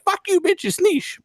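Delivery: very high-pitched mock executive; Yoshida. Host imagining the CEO's retort to critics, mock high voice. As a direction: put on a squeaky, dismissive caricature.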